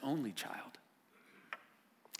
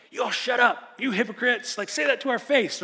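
louder, higher hammed-up retort; mock angry disciples; 11 semitones higher, 11 dB louder than usual. Disciples snapping at the scribes, mock angry retort. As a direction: snap back like riled-up disciples. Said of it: "Or maybe perhaps they were like".

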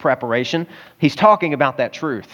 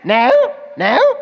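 put-on toddler saying no; 8 semitones higher, much slower than usual. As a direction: mimic a small child refusing. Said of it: "He's like".

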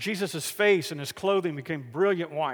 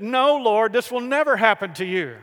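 raised, forceful refusal; emphatic protest; 4 semitones higher, 5 dB louder than usual. Acted. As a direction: object firmly, loud on the "No". Peter protesting Jesus' coming death.

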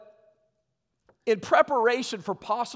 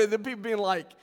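ordinary talk, nothing marked

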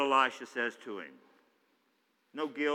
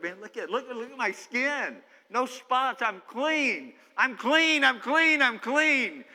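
higher and louder, building joyful cries; overjoyed Naaman; 9 semitones higher, 4 dB louder, slower than usual. Acted. Healed Naaman showing the prophet his skin, rising joyful cries. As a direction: shout it like you can't believe your skin.

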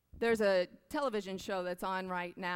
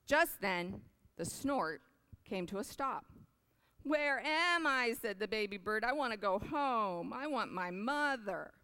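storybook reading; 4 semitones higher than usual. A narrator's reading. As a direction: read it like a bedtime picture book. Reads picture book aloud.

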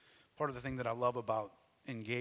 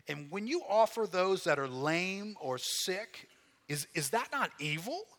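God's indignant challenge, rising higher and louder; forceful scripture reading; 4 semitones higher, 5 dB louder than usual. Acted. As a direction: press each accusing question home with force. Reads God's accusation.

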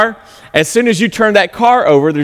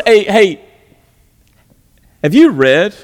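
sarcastic; 3 semitones higher than usual. Acted. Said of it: "Jesus looks at them sarcastically in their grills".

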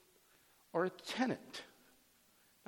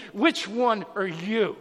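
slow, loud, higher demand; retold judge's wrath; 4 semitones higher, 7 dB louder, slower than usual. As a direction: bear down on each word as an irate judge. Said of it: "the wrath of the judge".

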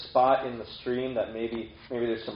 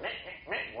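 short, high squeaky "meh"s; high chittering animal noise; imitated animal noise; 7 semitones higher, 9 dB quieter, slower than usual. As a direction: imitate a squirrel's repeated little call. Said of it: "Why is he making that noise?".

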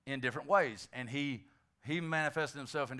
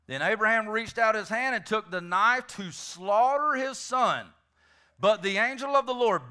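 ordinary talk, nothing marked

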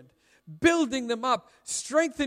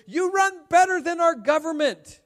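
high, loud reading of a sign; called-out cheer; 6 semitones higher, 4 dB louder than usual. Acted. Preacher reading a marathon spectator's sign, called out.

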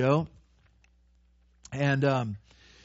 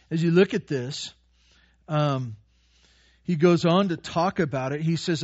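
usual speaking voice

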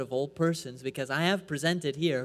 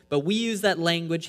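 ordinary talk

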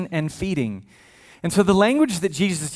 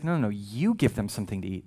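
calm Jesus; 6 semitones lower, 6 dB quieter than usual. Retold or imitated. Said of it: "Jesus is like".

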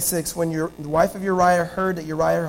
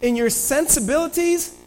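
higher-pitched scheming king; scheming David; 6 semitones higher than usual. Acted. David plotting to get Uriah drunk, lightly sly. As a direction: lay out the plan with sly intent. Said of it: "David's like".

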